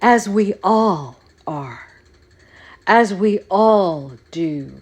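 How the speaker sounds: slow, grand, put-on orator voice; mock a self-important aristocrat; lofty mock politician, drawn out; pompous politician. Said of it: "politicians and aristocrats are, will be like".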